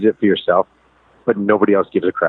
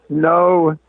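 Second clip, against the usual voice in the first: daughter refusing; 8 semitones higher than usual. Retold or imitated. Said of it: "I said".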